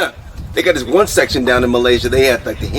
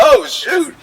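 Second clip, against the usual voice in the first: surprised; 13 semitones higher than usual. Retold or imitated. Surprised relief.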